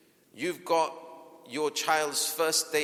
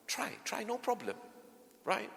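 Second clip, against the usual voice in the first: much higher, softer encouragement; encouraging parent; 7 semitones higher, 7 dB quieter than usual. Retold or imitated. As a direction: cheer the child on gently.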